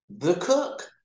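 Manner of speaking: high, pointed disbelief; incredulous. Retold or imitated. Telling Ed who is downstairs, incredulous. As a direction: say it like it can't be true.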